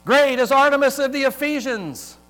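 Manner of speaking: high, loud crowd cry. A narrator's reading. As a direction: shout it like an enraged crowd. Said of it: "were crying out".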